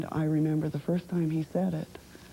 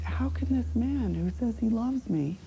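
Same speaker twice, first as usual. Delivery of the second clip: hurt, higher-pitched disbelief; devastated; 9 semitones higher than usual. Retold or imitated. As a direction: voice climbing high in wounded protest. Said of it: "being devastated by it and wondering".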